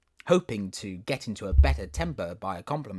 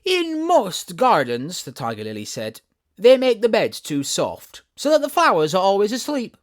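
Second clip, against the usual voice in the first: tiger lily, lecturing; 10 semitones higher, 11 dB louder than usual. Acted. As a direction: explain it as if obvious.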